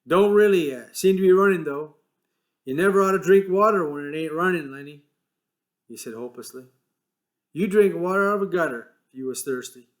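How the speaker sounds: a touch of weary scolding; George's voice. A narrator's reading. George lecturing Lenny about water, exasperated.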